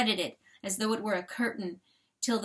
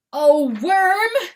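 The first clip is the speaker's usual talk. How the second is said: anguished cry; 9 semitones higher, 12 dB louder than usual. A narrator's reading. Rooster grieving his dead friend.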